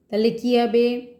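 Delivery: normal talking voice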